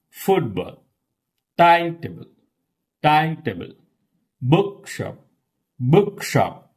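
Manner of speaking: "Timetable" as TIME-table, "Bookshop" as BOOK-shop; stress on first syllable. Shown as a demonstration. Teacher drills compound nouns, first part stressed. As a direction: hit the first word, swallow the second in "Football", "Timetable", "Bookshop". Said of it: "you observe the second word".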